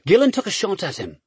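plain reading voice

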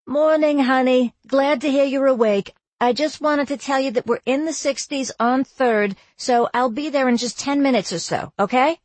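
a cheery mom calling early; booming mom. Acted. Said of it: "My mom's voice came booming over the line".

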